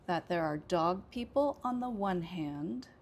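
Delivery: "hand" said loudest; stress on hand. Shown as a demonstration. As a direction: land the weight on "hand" at the end.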